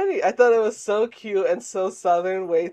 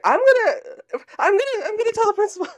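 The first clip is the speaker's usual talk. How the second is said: high whiny scared tattling voice; scared kid; 6 dB louder than usual. Retold or imitated. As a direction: squeak it out like a frightened kid tattling. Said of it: "Like, he got scared".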